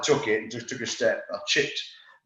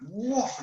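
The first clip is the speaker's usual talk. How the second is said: mimicked throw whoosh; 10 semitones higher, 5 dB quieter than usual. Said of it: "and he goes".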